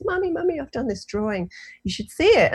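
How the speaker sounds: put-on high, eager little girl calling mummy; excited child. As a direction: play the excited seven-year-old. Voicing her excited daughter.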